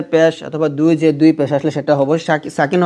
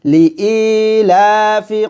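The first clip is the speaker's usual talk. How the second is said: slow, drawn-out recitation of লিইলাফি কুরাইশিন; slow Quranic recitation; 3 semitones higher, much slower than usual. Shown as a demonstration. Teacher reciting the verse for students, slow and drawn out. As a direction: chant it slowly and evenly. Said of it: "এরকমভাবে".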